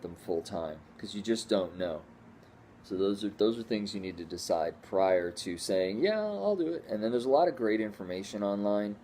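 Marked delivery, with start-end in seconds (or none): 6.0-6.8 eager yes. Retold or imitated. Quoting a hasty yes.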